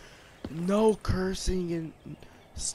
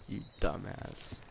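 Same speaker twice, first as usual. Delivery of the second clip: whispered insult; 10 semitones lower, 13 dB quieter than usual. Real, not acted. Muttered insult under breath.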